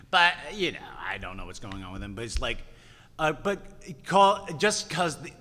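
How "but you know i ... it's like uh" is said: ordinary talk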